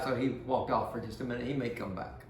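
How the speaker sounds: ordinary delivery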